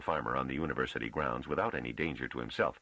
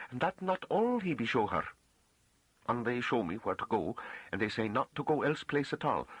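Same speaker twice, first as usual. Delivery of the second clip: high, naive rustic woman voice; earnest farm woman; 9 semitones higher than usual. A narrator's reading. Farm woman tells the master of the gatekeepers, naively earnest. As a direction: recount it high and artless in country dialect.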